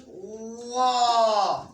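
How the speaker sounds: whoa drawn out slowly, full-mouthed and heavy; slow careful demonstration of whoa; slow demo. Shown as a demonstration. Teacher models the full-mouth letter for the class slowly. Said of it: "make the middle of the tongue touch the top".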